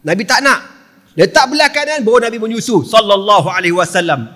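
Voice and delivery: normal talking voice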